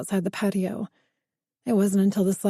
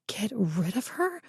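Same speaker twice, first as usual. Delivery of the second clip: rising, disbelieving repeat, softer; incredulous; 7 dB quieter than usual. Acted. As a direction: echo the order in shocked disbelief. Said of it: "frantic and desperate".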